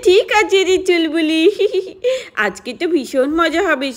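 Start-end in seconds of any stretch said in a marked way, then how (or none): 1.5-2.4 giggle. Acted. Plotting prank, giggles.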